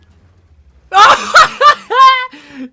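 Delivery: huge shrieking laugh; high, shouted cackle of triumph; excited laughter. Real, not acted. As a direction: erupt in loud, very high laughter. Erupts as the kill lands.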